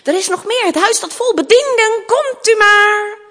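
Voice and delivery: high, rising summons to the servants; calling out. Acted. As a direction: build to a long, loud royal call. King calls in the servants. (luid de bedienden roepen)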